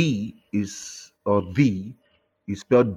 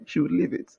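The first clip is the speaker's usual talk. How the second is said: ordinary delivery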